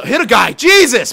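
high, loud burst; exasperated exclamation. Real, not acted. Player watching teammates miss hits, exasperated exclamation. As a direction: cry it out in frustration.

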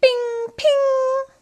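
very high, loud ringing ping; magic gunshot. A narrator's reading. Narrator voicing the magic shot, loud ringing ping. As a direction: make the pistol sing out. Pistol sound effect.

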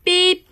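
high loud beep; intercom beep. Pretend. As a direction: sing out a bright beep. Intercom before principal announcement.

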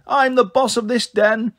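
high, mildly yelled boast; a small boy's playground yell, held back in volume. A narrator's reading.

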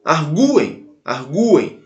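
"Arguem" with a clearly sounded, stressed gu; stressed U pronounced. Shown as a demonstration. Teacher showing the pronunciation is unchanged, "arguem" with the U stressed. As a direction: lean on the U in each "arguem". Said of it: "A pronúncia continua".

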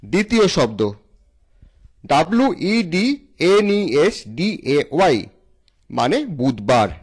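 ordinary talk, nothing marked

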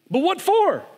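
high and loud, shocked accusation; accusing boy. Acted. Boy accusing the lion of hurting his friend, shocked. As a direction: blurt it out in shock.

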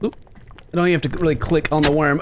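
ordinary delivery

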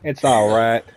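mock Southern hillbilly voice; Southern accent. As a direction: explain it like a country yokel. Playing Southerner about Cletus.

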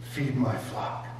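soft, low tender instruction; gentle command. Acted. Jesus gently telling Peter, soft and low. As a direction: say it softly, like a shepherd.

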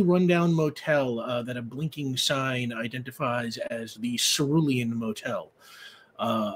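game master painting the scene, measured and vivid; opening narration of a new season; scene-setting narration. Acted. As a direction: draw the table into the motel lobby.

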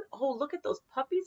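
usual speaking voice

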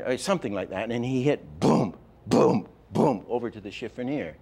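ordinary talk

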